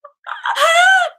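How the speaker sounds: very high, loud wailing laugh; very high, loud cry-laugh burst. Shown as a demonstration. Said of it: "what I just showed you".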